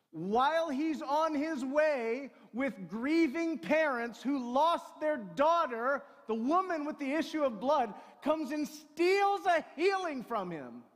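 loud, high, driving sermon voice; a pastor at full volume building to a peak; preaching at full force. Acted. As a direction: preach it out over the whole room. Preaching to the congregation.

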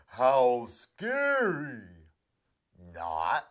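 slow, loud mocking moo; deadpan sarcasm; sarcastic cow. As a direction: drag out the scorn. Cow mocks mouse.

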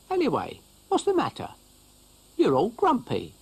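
a small chirpy creature; high snail voice. Acted. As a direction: snail teasing the grumpy dog. Brian the snail talking.

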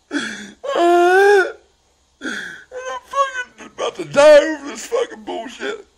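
very high, loud crying, words dragged out; real sobbing. Real, not acted. Husband breaking down over what wife told him, sobbing. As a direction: wail it through real tears.

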